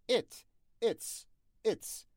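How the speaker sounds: ات said with a short clipped e, not ایت; short e sound. Shown as a demonstration. Teacher modelling ات with a short e for students. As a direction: say ات short like "et", never long "eet". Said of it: "ایت نمیگیم".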